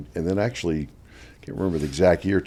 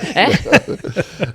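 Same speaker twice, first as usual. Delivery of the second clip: laughter; 6 dB louder than usual. Real, not acted. Laughing at his own story.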